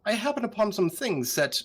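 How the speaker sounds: evasive, oily schemer voice; slimy accent. Acted. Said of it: "drop the fucking slimy accent".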